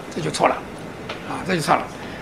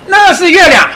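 raised, emphatic showing voice; louder, higher voice showing a child the moon; pointing it out; 9 semitones higher, 6 dB louder than usual. Retold or imitated. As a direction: call out as if pointing up at the sky.

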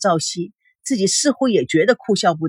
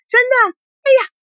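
higher and louder, eager cry; excited squeal; 12 semitones higher, 4 dB louder, faster than usual. A narrator's reading. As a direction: jump up and shout it out eagerly. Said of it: "嗓音提高了八度，叫着说".

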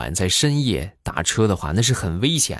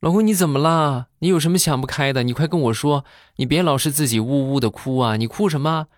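high, fussing wife; anxious coaxing in a raised voice; worried wife; 6 semitones higher than usual. Retold or imitated. Wife at the door coaxing her husband, high and worried.

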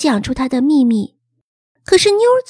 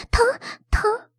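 light cry of pain; 6 semitones higher, 4 dB quieter than usual. A narrator's reading. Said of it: "她轻喊了一声".

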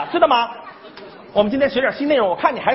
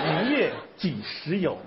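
poem recitation; 8 semitones lower, 7 dB quieter than usual. Shown as a demonstration. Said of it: "老师跟你对诗".

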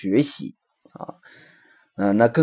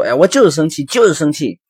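put-on higher, louder child's stubborn protest; acted angry insistence; 5 semitones higher, 5 dB louder than usual. Angry child talking back to the father, acted insistence. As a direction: protest crossly, pitched up and forceful.

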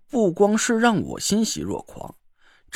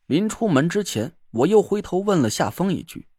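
ordinary delivery, nothing marked

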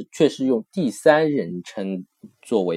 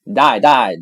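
loud Dai dai as encouragement; teacher cheering in Italian; 7 dB louder than usual. Shown as a demonstration. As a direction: cheer someone on. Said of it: "跟人家加油说".

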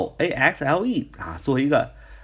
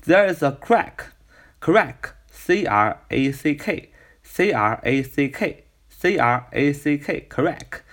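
slow spelling; 2 semitones higher, much slower than usual. Shown as a demonstration. Teacher spelling crack for students, slow. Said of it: "我们来分析这样一个句子".

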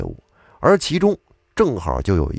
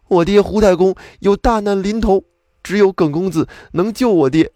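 higher, pleading son on his knees; sorrowful pleading; 10 semitones higher, 4 dB louder than usual. A narrator's reading. Kneeling son begs the scholar to save his father, sorrowfully pleading. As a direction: beg for your father's life, voice pitched up. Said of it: "悲悲切切的恳求他说".

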